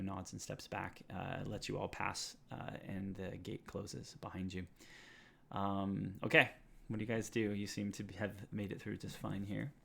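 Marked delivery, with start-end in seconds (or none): none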